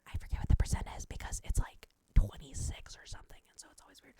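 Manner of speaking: unvoiced whispered aside. Real, not acted. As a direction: drop to a breathy secret. Whispered aside about percent.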